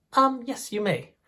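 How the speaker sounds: a light British penguin yes. Acted. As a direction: grant the handshake in a prim British accent. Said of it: "made both of you with British accents".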